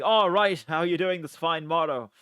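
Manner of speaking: put-on olde-worlde hello; mock medieval greeting. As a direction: hail a passer-by in a playful medieval voice.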